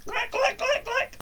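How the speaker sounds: high squeaky click click sound effect. A narrator's reading. Storyteller voicing the hut's walking legs, high clicks. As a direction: click it out like little chicken feet.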